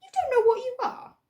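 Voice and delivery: very high, loud astonished squeak; incredulous creature voice. Acted. Another hidden creature asks Hester, high and astonished. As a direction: squeak it out in disbelief. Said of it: "A different voice again".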